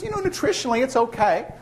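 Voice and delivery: man explaining. Retold or imitated. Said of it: "He says".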